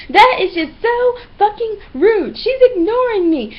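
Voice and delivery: high, slow, drawn-out whine; over-the-top wounded pouting; mock whining complaint. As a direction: imitate a sulking tattletale. Imitates man whining around class.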